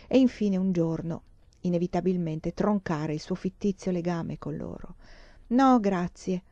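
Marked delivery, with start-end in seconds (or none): none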